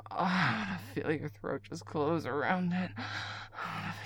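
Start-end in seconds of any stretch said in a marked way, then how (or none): none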